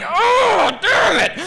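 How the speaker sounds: high, loud acted yelp of pain; pained cursing. Storyteller reliving grabbing a fistful of thorns, pained shout. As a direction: yell it as if freshly hurt.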